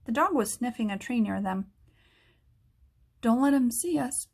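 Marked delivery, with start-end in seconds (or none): none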